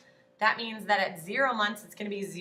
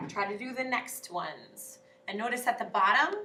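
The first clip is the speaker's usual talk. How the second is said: ordinary talk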